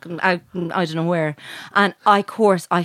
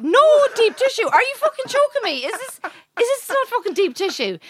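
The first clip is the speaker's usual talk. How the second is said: re-enacted high-pitched disbelief; incredulous protest; 12 semitones higher than usual. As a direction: protest indignantly, voice shooting right up. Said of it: "I said".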